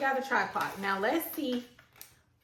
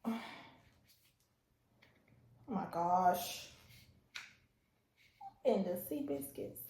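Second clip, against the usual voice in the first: ordinary delivery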